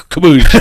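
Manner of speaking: loud, high "kaboosh"; imitated crash. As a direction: shout a comic splash-crash noise. Imitates the deer crashing through ice.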